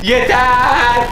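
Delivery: a sudden loud, high laughing shout; real shouted Yeter! through laughter. Real, not acted. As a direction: burst out laughing and yell enough. Laughing, cutting off teasing question.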